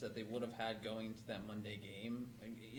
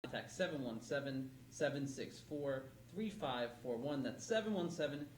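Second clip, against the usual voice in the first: smooth radio ad read; announcer voice. Acted.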